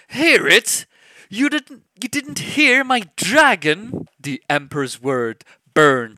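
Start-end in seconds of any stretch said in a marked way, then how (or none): 0.1-4.1 much higher, loud outraged cry. Acted. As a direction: burst out in disbelief and fury. Emperor enraged at Chanlo.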